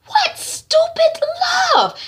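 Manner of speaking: very high, slow, loud wail; exasperated outrage. Retold or imitated. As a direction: draw it out in acted disgust. Mother rages at daughter.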